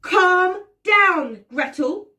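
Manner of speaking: steady her firmly; Hansel soothing. Acted. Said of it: "Hansel says again".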